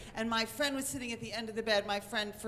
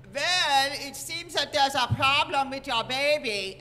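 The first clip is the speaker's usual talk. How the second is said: loud mock German-accented doctor; German-sounding accent; 3 semitones higher, 8 dB louder than usual. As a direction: suave Scandinavian doctor who sounds German. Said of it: "all I hear is German".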